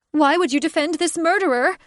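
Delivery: high, loud accusing cry; furious bird woman. Acted. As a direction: screech it at the man she loves. Catherine screams at him.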